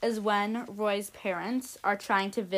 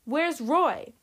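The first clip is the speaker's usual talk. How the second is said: gasped in alarm; 4 semitones higher than usual. A narrator's reading. Mother to the doctor, alarmed gasped question. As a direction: let it burst out as she sees the empty bed. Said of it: "Missus Ebenhart gasped".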